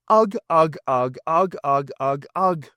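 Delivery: mock caveman grunting; hammed-up caveman grunts.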